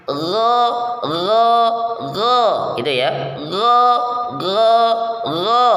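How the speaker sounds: gho dengan pangkal lidah naik; pelafalan gho isti'la. Shown as a demonstration. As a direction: gho tebal, lidah terangkat. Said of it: "pangkal lidahnya naik". (gho isti'la)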